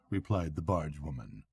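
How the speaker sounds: ordinary delivery, nothing marked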